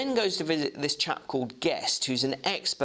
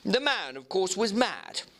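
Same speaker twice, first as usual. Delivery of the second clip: higher, brisk eager clerk; clerk Guest; 7 semitones higher than usual. Acted. Clerk Guest answering the lawyer, in a higher eager voice. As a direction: pipe up politely as a keen young clerk.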